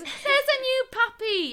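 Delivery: high, loud fake-chirpy announcement; sing-song mock consolation; mock cheer. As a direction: present it as a wonderful fix. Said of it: "your solution to the grief".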